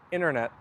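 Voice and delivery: "Internet" as innernet; slangy "Internet". Shown as a demonstration. Streamer repeating the casual form, "Internet" without first t. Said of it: "you slang a lot of the words".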